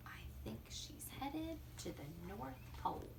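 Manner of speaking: hushed storyteller aside; whisper. Acted. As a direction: lean in and whisper to the listener.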